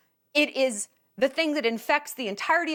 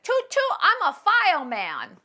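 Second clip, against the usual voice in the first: put-on high, loud, drawn-out toddler voice; 8 semitones higher, 5 dB louder than usual. Toddler announcing his pretend job, loud toddler voice. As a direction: play an excited little kid stretching each word. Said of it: "the way a toddler is adorable, when he, when he's like".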